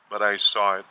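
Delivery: ordinary talk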